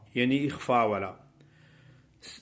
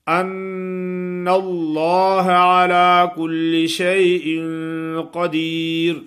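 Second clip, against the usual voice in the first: slow, loud, high chant with a held ghunna; tajweed recitation; 4 semitones higher, 6 dB louder, much slower than usual. Acted. Teacher recites the verse for students, loud tajweed chant. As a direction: recite slowly with the nun held.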